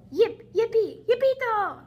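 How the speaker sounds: high, loud yelping cheer; excited cheer. Acted. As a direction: whoop it out like a victory. Dogs cheer the hero.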